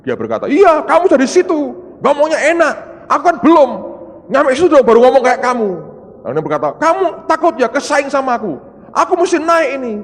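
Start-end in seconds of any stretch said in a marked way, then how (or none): 0.5-5.9 loud, high indignant comeback; imitated angry retort. Annoyed minister snapping back at the preacher, mock angry. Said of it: "Beberapa marah". 6.8-9.9 high, loud jealous jab; imitated angry taunt. Minister accusing the preacher of jealousy, mock angry. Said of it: "Beberapa marah".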